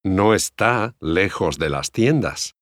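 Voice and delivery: usual speaking voice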